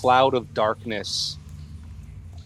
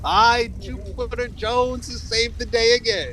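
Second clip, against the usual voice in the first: pompous hero; 12 semitones higher than usual. Acted. Hero announces he has saved the day, pompous. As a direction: play a self-important rescuer proclaiming his name. GM announces himself as heroic character.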